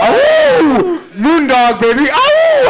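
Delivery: high loud yelping howl; howling like a wolf pup. Pretend. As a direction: throw back your head and howl. Howling shout.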